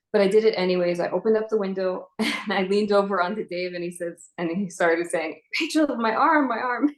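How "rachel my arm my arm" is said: high, pained plea; crying out in pain. Retold or imitated. Wounded husband begs his wife, high and pained.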